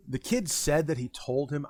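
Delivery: ordinary talk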